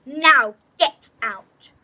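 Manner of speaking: loud stern headmistress command; shouted order. Acted. Headmistress orders the girl out of the study, shouting. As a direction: throw her out with a shout.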